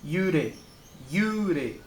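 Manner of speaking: "Yure" with the U held long; "yure" with one drawn-out U before a short re. Shown as a demonstration. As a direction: stretch the double U in "yure". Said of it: "Tem um duplo U".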